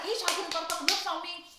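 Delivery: high, fast, fired-up retelling; a hammed-up angry rant that ends loud; heated complaint. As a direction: rattle it off furious and offended. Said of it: "I was like".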